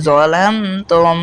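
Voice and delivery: slow "যালামতুম", m closing the syllable "লাম"; measured "যালামতুম" with m held before "তুম"; joined consonant. Shown as a demonstration. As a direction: join "লাম" in one sound, then "তুম". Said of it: "লাম কিন্তু মিমকে গিয়ে ধরছে".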